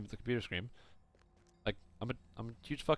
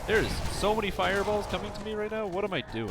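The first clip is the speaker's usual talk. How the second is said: high, louder panicked complaint; flustered and raised in pitch; alarmed exclamation; 9 semitones higher, 4 dB louder than usual. Real, not acted. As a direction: blurt it out under fire in a game. Under fireball attack in game.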